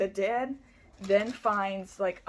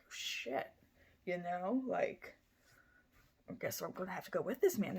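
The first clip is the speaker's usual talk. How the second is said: hushed quote; 7 dB quieter than usual. Retold or imitated. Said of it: "So she's like".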